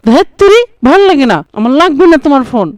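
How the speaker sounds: high, loud frustrated yell at mum; petulant teenage tantrum, loud and high; angry outburst. Acted. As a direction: stamp your foot and shout it. Sulking boy snaps at mother.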